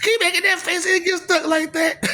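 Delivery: high, put-on scolding mom voice; mock parent warning. Mimicking a parent scolding a child about faces, high put-on voice. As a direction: warn like a nagging mother.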